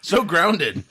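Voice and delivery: high, loud and laughing. Real, not acted. Laughing over the cokehead role.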